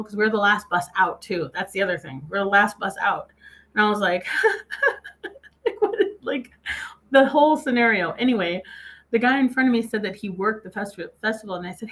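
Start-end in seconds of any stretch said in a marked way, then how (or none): 4.4-8.1 breathy high laugh running into the words. Real, not acted. Recalling the bus chaos, laughing in disbelief. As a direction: laugh in disbelief through the line.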